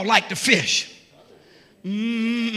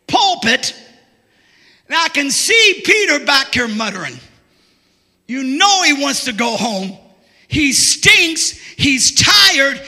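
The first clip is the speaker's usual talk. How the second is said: loud, slow, building sermon shout; full-force preaching; 4 semitones higher, 5 dB louder than usual. Acted. Preacher shouting the story of Peter to his church. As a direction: preach it out to the rafters.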